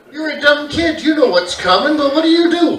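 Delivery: usual speaking voice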